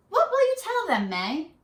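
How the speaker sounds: high, gentle question from mom; a hint of a caring mother; lightly voiced mother. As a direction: ask it like a curious mother. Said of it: "her mother said".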